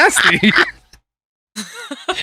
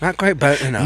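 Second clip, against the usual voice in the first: low, softened appraisal; mock chef; 10 semitones lower, 6 dB quieter than usual. Co-host mimicking the chef checking rice, hushed. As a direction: murmur it like a chef inspecting the pan. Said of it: "why is he whispering".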